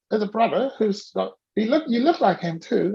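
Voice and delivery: ordinary delivery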